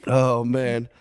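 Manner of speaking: normal talking voice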